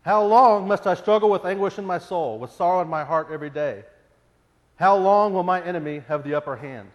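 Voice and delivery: loud anguished psalm reading; impassioned lament. Acted. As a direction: plead with God, almost shouting.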